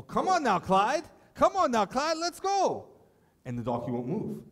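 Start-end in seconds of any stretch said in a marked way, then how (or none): 0.0-2.9 put-on high, louder coaxing old man; old husband coaxing. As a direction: coax the stubborn donkey in a high voice. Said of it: "And he's all".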